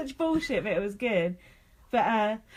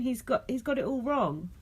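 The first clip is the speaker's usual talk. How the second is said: normal talking voice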